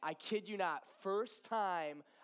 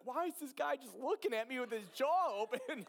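high, loud imitation of her bafflement; baffled reaction; 8 semitones higher, 5 dB louder than usual. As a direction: mimic her wondering about the staring guy. Voicing her baffled thought.

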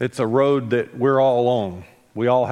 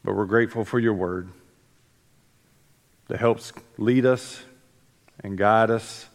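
prayer; 3 semitones lower than usual. Real, not acted. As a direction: pray gently over the congregation. Opening prayer before the sermon.

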